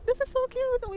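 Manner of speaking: high squeal of delight, really gushing. Real, not acted. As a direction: melt over how cute it is. Gushing over cute outfit.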